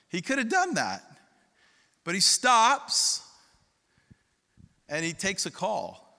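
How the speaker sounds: normal talking voice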